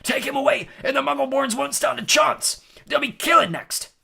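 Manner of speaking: high, loud, desperate shouting; yelled. A narrator's reading. Hagrid warns about the Muggleborns, yelling. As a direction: shout a furious warning. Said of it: "yelled Hagrid".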